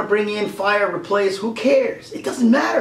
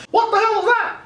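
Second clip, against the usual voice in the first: much higher, startled yelp; startled Mickey. Pretend. Mickey woken by a ghost, high startled cry. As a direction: jump awake in cartoon alarm.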